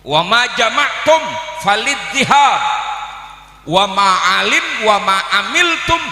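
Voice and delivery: loud, high, ringing Arabic recitation; emphatic declamation. Acted. Preacher quotes an Arabic maxim to the congregation, declaiming loudly. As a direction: proclaim each phrase forcefully, with long pauses.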